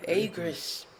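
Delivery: sudden loud call. Acted. Dragon exclaims the name.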